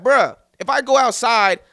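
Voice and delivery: exasperated protest. Retold or imitated. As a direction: retell it with worked-up disbelief. Retelling his protest to friend.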